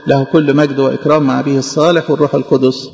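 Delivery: low liturgical close; closing doxology. Real, not acted. Preacher ending the sermon with glory and amen, liturgical.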